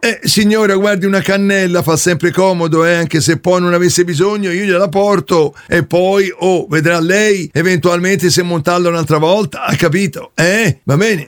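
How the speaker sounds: plumber character voice. Acted. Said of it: "io sono Caio, il trombaio".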